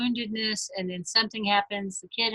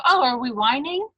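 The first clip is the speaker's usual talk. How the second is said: put-on higher, rising question to a toddler; playful mock question; 5 semitones higher, 4 dB louder than usual. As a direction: ask it like a grandma joining the game. Grandmother voiced teasing a toddler's tantrum.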